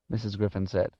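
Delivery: ordinary delivery, nothing marked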